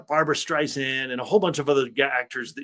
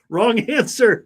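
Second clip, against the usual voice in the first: slow, high and louder through a laugh; laughing verdict; 8 semitones higher, 6 dB louder, much slower than usual. Real, not acted. Host reacting to the guest's dad story, laughing. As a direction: laugh it out as a mock quiz verdict.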